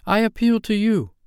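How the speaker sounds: high-pitched cry for help; acted high, pleading call; urgent appeal. A narrator's reading. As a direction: appeal to him in her extremity. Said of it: "she cried".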